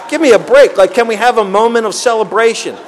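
loud, high, fed-up groan of a thought; exasperated. Retold or imitated. Thinking back at his sponsor, loud and exasperated. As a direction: protest like you just want a break.

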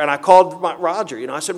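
normal talking voice

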